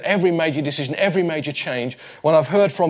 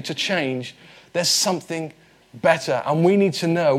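ordinary delivery

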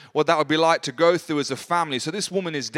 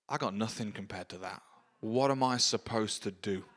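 much lower and much softer, defeated murmur; deflated instinct; 8 semitones lower, 11 dB quieter than usual. Retold or imitated. Believer facing a huge need, quietly deflated and hopeless. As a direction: sink into it like you've given up. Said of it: "my instinct response is".

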